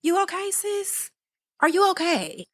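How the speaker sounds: high, concerned checking in; worried friend. Retold or imitated. Friend confronting sis, high worried questions. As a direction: coax a lost friend gently but high.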